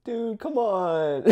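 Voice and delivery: high, slow exasperated groan; whiny incredulous dude; exasperated mock. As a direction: drag it out in disbelief. Mocking the cops' failure.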